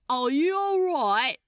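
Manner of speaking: put-on loud, thick Cockney accent; broad Cockney repeating the question; a fellow in heavy Cockney translating for the stoker. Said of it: "his Cockney accent so thick".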